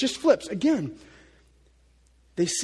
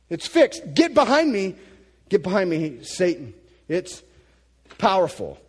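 loud, driving preacher voice; preaching louder; 11 semitones higher, 12 dB louder than usual. Acted. Preacher scolding modern views of Jesus, loud and driving. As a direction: press the point home hard.

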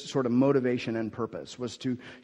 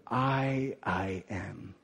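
"I, I am" with a held first I, then dropping low; slow, weighty doubled "I"; emphatic; 6 semitones lower, 7 dB quieter, much slower than usual. Acted. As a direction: let "I am" sink, low and deliberate. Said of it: "built into the language is this emphasis".

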